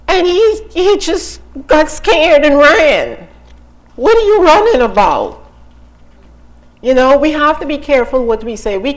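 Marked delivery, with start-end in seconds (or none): 0.1-5.4 high, exasperated disbelief; incredulous. Acted. Preacher challenging the fleeing prophet, loudly incredulous. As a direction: pitch it up, as if you cannot believe he fled.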